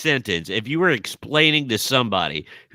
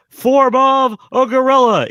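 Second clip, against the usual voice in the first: high, loud, stretched-out transformation call; mock yelling out; 12 semitones higher, 7 dB louder, slower than usual. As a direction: announce your superpower in a big cartoon shout. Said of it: "if you're yelling out".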